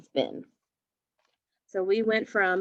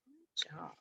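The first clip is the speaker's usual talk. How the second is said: whisper; 5 semitones lower, 17 dB quieter than usual. Real, not acted. Whispered praise to class.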